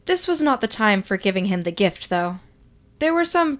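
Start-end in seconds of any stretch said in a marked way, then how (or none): none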